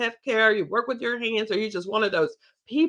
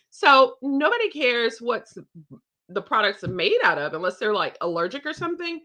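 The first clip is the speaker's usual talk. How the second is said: ordinary delivery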